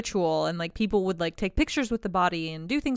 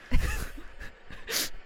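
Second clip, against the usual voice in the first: shocked squeaky laugh; 4 dB quieter than usual. Real, not acted. Shocked by cremation video.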